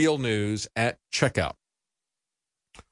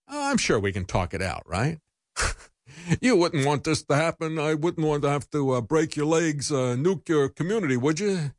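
lightly voiced mock mobster, higher; mock mafia threat; 3 semitones higher than usual. Host mocking congressman's threat as a mafia heavy. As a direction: menace them like a joking gangster. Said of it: "this mafia-like reminder".

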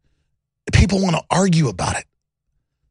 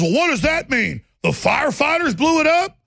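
imitating Bolling; 10 semitones higher than usual. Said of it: "He goes".

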